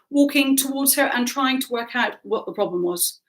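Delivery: usual speaking voice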